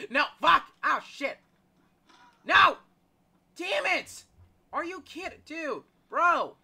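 loud, high outcry of disbelief; exasperated yelling. Real, not acted. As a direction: protest loudly at getting caught. Caught by the monster.

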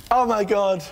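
loud, thrilled exclamation; excited outburst. Real, not acted. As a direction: burst out with the news, overjoyed. Bursts in with big news.

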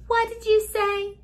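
high, bright "what did you say?"; playful mock surprise. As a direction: tease a toddler with fake surprise.

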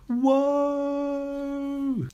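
high, long piercing wail. A narrator's reading. Teacher wailing at the headmistress, high and piercing. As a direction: let out a glass-shattering wail. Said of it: "She wailed even louder than before".

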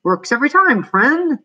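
high, lilting assurance; singsong high exterminator voice; exterminator character. A narrator's reading. Exterminator figure assures the customer.

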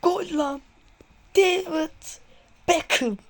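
high, loud jubilant cries; goal celebration shouts. Real, not acted. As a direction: keep cheering right after the goal.

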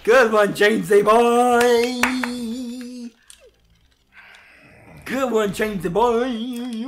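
loud, high, drawn-out holler; mock cheer. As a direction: belt it out and hold the last word. Mock cheer at friend, repeated.

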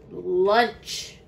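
low, loud crow voice; hungry crow. A narrator's reading. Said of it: "said a hungry crow".

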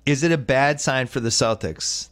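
usual speaking voice

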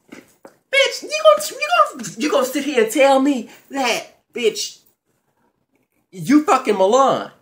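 high, shrieking, fast bitch you gonna; outraged disbelief. Pretend. Confronting the ex over Milan, high-pitched outrage. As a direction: squeal it up high in mock outrage.

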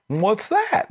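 high puzzled question; puzzled local asking; baffled question. Retold or imitated.

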